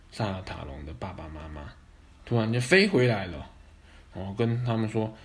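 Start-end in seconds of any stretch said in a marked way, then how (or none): 0.1-1.6 soft, hushed narration; quiet. A narrator's reading. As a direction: drop to a murmur. Narration, mentions the parents.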